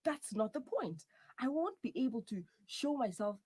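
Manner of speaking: high-pitched, aggrieved complaint; upset protest. Acted. Partner argues about shaved hair, whining in protest.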